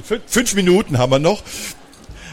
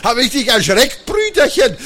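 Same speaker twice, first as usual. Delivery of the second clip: high, gleeful teasing voice; laughing, teasing; 9 semitones higher, 3 dB louder than usual. A narrator's reading. Sister mocks the brother she just scared, laughing. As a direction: crow at him through laughter, rising higher. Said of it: "lachend Magda auf".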